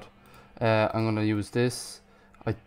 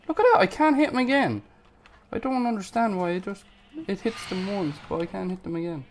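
exasperated complaint; 8 semitones higher, faster than usual. Real, not acted. Player who can't hit the phantom again, exasperated. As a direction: complain in a high, strained-pitch protest.

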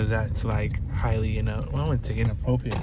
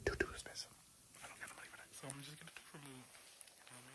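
real whisper, unvoiced; 22 dB quieter, faster than usual. Real, not acted. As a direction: breathe the words, no voice. Whispering at the dentist.

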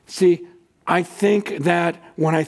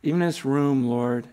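closing prayer. Acted.

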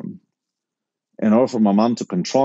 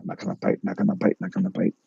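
low, soft repeated chant; muttered mantra; 3 semitones lower, 6 dB quieter, faster than usual. Retold or imitated. Son steeling himself at the booth, quietly muttered mantra. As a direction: mumble it to yourself under your breath. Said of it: "I'm still doing my mantra".

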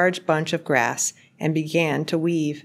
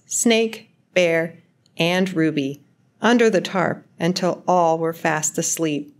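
ordinary delivery, nothing marked

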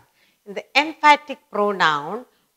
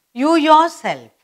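loud announcement; 8 semitones higher, 9 dB louder than usual. Shown as a demonstration. Teacher announces next example.

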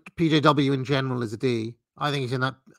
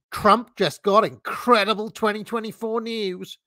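much higher, excited headline read; Steve Turley's high voice; 8 semitones higher, 3 dB louder than usual. Acted. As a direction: mimic a YouTuber's high-pitched hype voice. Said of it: "he's not doing the high voice".

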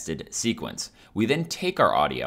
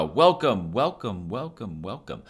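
fading echo; slower than usual. Shown as a demonstration. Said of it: "The delay is going to keep repeating it".

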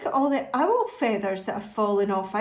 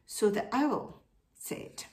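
ordinary delivery

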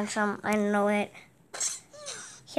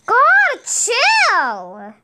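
exasperated chill; 9 semitones higher, 7 dB louder than usual. Real, not acted. Annoyed at virtual baby crying.

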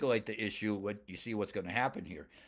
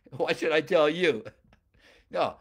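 louder, higher comeback; mock indignant retort; 3 semitones higher, 8 dB louder than usual. Samson refusing to tell his wife, mock indignant. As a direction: throw it back as an indignant question.

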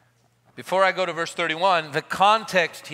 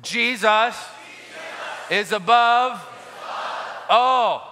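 shouted with conviction; 6 semitones higher, 4 dB louder, much slower than usual. Shown as a demonstration. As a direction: shout it, meaning every word. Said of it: "Come on, you've got to say it like you mean it".

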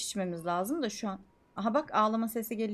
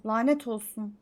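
usual speaking voice

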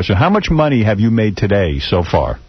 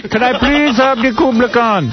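high, slow put-on voice; squeaky comic customer asking politely; mock terrorist buyer; 11 semitones higher than usual. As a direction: play a hammed-up high-voiced buyer begging Trump. Mock buyer asks for suite.

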